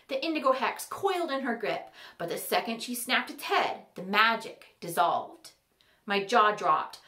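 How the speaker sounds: usual speaking voice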